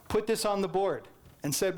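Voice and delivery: ordinary delivery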